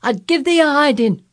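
high, loud furious yelling; angry old Yorkshireman. Acted. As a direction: bellow threats down the wall. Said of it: "he cried".

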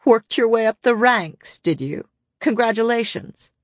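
high, mocking congratulations; snickering taunt. A narrator's reading. Jurian to Cassian on his promotion, snickering taunt. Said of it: "Jurian snickered".

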